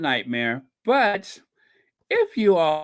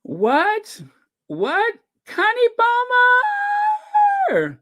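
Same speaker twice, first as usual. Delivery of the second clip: high, loud, drawn-out delighted call; thrilled, high-pitched shout-out; excited greeting; 5 dB louder, slower than usual. Real, not acted. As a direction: call out a guest's name in amazement. Greets a viewer who just appeared.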